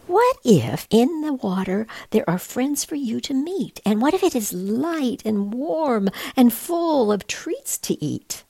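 mother penguin gently coaxing her little one; gentle mother penguin. Acted. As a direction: coax the scared chick kindly.

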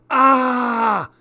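high sharp yelp of pain; sudden cry of pain. A narrator's reading. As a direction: yelp as the water burns. Water burns the wound.

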